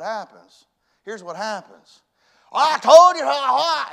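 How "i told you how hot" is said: high loud slowed mock rant; a husband shouting out his frustration; angry husband. Husband snapping back at a loving wife, loud and high.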